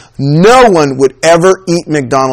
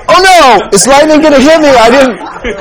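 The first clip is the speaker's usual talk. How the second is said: mock panic; 8 semitones higher than usual. Friend fearing lightning at Mass, mock panic. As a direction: panic as if caught doing wrong, hammed up.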